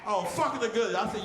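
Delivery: ordinary talk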